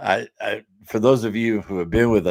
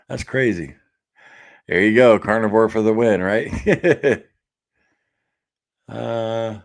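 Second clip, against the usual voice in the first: usual speaking voice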